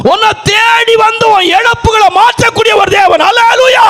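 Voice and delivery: rising to a high, loud preaching shout; fervent preacher climbing to a shout; preaching at full force. Acted. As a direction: build the sermon up until you are crying out.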